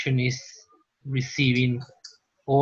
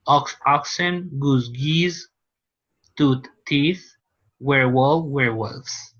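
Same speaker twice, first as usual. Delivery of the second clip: slow listing; slower than usual. Shown as a demonstration. Slowly listing irregular plurals.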